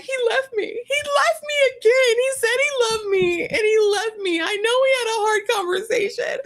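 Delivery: high wailing mock sob; mock crying. Host imitating Elise crying about Joshua, mock sobbing high. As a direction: wail it like a heartbroken contestant. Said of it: "she's like".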